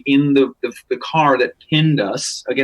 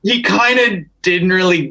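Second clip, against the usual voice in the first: normal talking voice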